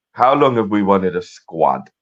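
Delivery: put-on Spanish accent. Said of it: "apologies for that terrible Spanish accent".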